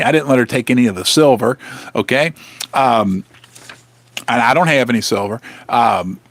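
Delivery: normal talking voice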